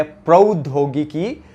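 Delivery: प्रौद्योगिकी said slowly with a clear prau; slow careful pronunciation. Shown as a demonstration. Teacher to students, slowly modelling the correct word. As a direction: stretch प्रौद्योगिकी, each syllable clear. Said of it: "जबकि होता क्या है".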